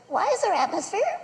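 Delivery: put-on high, small kid voice; curious child. Son keeps asking dad why, high curious voice. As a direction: press on with a high child's question. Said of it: "when they ask you about everything".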